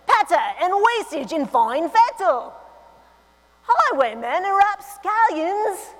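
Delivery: put-on playful British accent. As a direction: relish the words in a British accent. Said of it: "I should do this in a British accent".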